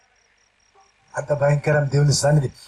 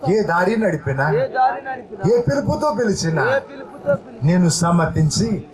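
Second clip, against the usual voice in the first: preacher leading prayer aloud, phrase by phrase; fervent prayer; 2 semitones higher than usual. Acted. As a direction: pour it out to God with the church. Leads congregation in prayer. (ప్రార్థనలో ఉత్సాహంగా)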